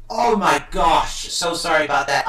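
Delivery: normal talking voice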